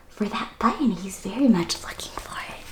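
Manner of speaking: soft whispered aside. Real, not acted. Teacher explaining the search, whispered. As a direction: hush it to the listeners.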